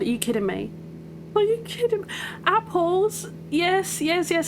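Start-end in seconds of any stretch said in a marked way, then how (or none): 1.3-4.5 real delight at finally finding it; excited shriek. Real, not acted. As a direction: shriek it out in disbelief. Sees apples on her map.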